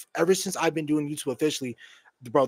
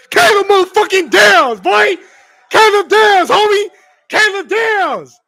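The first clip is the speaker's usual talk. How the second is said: high, loud screaming celebration; ecstatic shout; 13 dB louder, slower than usual. Real, not acted. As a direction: scream the name like your team just won. Celebrating the team's draft pick.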